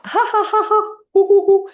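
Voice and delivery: high, loud panting hoo-hoo laugh; hot food laughter. Shown as a demonstration. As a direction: blow out the heat while laughing. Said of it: "the hot food laughter".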